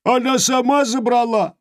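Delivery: high, small whiny creature voice; tiny forest creature complaining; голос Хороняки. Acted. (жалобный голосок Хороняки)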